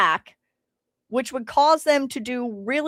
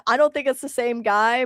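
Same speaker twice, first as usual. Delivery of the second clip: ordinary talk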